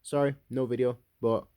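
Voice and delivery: usual speaking voice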